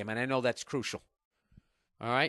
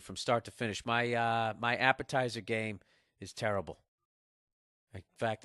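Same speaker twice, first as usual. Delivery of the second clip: normal talking voice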